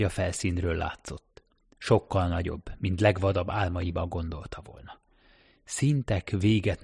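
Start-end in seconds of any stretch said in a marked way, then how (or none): none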